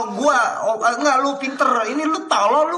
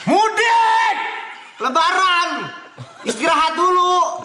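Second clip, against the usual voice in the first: shouted call; 6 semitones higher, slower than usual. Real, not acted. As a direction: belt it out like a holiday cheer. Streamer yelling out to chat.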